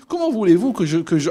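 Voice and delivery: high-pitched mock German accent; lively imitation of a German accent. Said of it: "avec son accent allemand".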